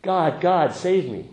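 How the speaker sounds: loud, high cry for help; desperate yell. Acted. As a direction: call up to heaven, hammed-up panic. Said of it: "he yells out".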